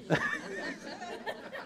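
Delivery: breathless high silent laugh; a giggle that stays mostly breath; airy giggle. Real, not acted. Preacher giggles at her own joke, airy and breathless.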